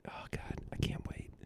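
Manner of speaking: real whispered aside, very soft; hushed, voiceless aside; anticipating whisper. Real, not acted. Teller anticipating the listener's reaction.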